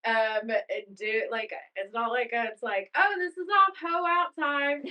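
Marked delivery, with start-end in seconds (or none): 3.0-4.8 high, loud mock wild; hamming up a naughty freedom cry; cheeky mock celebration; mock party mode. Mock-rowdy imagined thought.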